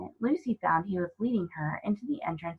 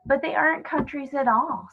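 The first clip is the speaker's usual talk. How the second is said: bright, higher voice on the edge of a laugh; a hint of laughter breaking through; almost laughing; 7 semitones higher than usual. A narrator's reading. Lucy tells the faun, nearly laughing. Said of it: "almost laughing".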